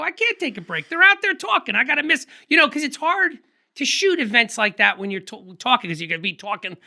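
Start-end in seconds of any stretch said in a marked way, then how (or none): 0.0-2.3 much higher, pitched-up pleading rush; urgent retold protest. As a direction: protest as if you can't afford to stop. Retold protest against taking a break.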